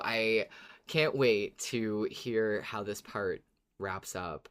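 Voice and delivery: normal talking voice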